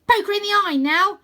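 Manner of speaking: loud, high ringside yell; urgent, high-pitched coaching shout; shouted tactical advice. A narrator's reading. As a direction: yell it from the edge of a fight. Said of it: "offering tactical advice like".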